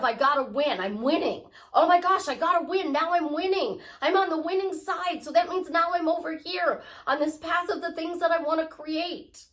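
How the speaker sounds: high, loud, driven cheering; pumped up. Shown as a demonstration. Speaker showing how to pray for winning, loud high pep. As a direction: psych yourself up to win.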